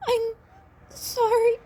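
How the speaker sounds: high, choked-up sorry; tearful apology. Acted. Izuku apologizing tearfully to his teacher. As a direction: apologize through tears.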